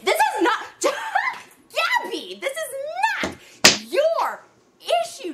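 high, loud laughing disbelief; laughing outrage. Real, not acted. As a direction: laugh it out, then shout. Laughing outburst at Gabby.

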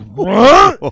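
loud, high incredulous yelp; shocked what. Retold or imitated. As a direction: blurt it out in disbelief. Audience shocked by nine eleven joke.

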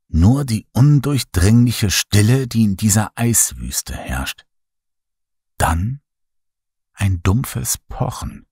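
plain reading voice

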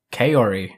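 Kaori said "KAY-or-ee", falling; Kaori as a settled "kay-ori"; Americanized pronunciation. Shown as a demonstration. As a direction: say Kaori with a long "kay" and English r. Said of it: "I'm gonna say Kaori".

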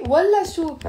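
والله شو high and loud, falling; scornful refusal. Shown as a demonstration. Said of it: "You see the tone in my, of my voice?".

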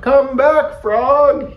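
very high, loud desperate call; pleading shout. A narrator's reading. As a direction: shout for your friend to return. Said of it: "he shouted".

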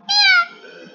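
squeaky imitated meow, high and bright. Imitating the mewing cats for viewers with a high meow. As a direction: mimic a kitten's meow.

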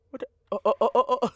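ordinary talk

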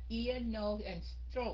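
nose and throat said slowly; teacher slows down nose and throat for learners; slow demo. Shown as a demonstration.

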